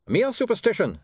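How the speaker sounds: dismissive. Acted. Otto dismissive.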